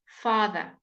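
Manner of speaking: father with a clear voiced th. Shown as a demonstration. Teacher practising the th sound with students. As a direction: press the th in father. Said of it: "practice the T H sound".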